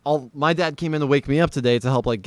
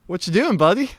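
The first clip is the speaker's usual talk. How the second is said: dad confronting guest; 7 semitones higher, 4 dB louder than usual. Retold or imitated. Imitates dad confronting drunk guest.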